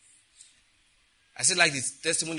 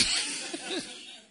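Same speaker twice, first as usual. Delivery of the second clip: high wheezy laugh; 5 dB quieter than usual. Real, not acted. Preacher amused by his own joke, laughing.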